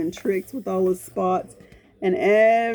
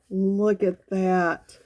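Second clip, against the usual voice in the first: usual speaking voice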